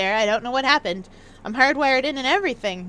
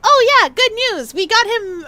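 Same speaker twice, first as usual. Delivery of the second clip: excited; 7 semitones higher, 6 dB louder than usual. Real, not acted. Excited news to a viewer.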